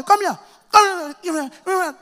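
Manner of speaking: high, sharp repeated call; mother calling. Retold or imitated. Mother calling Ezekiel to come home. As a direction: summon the child home.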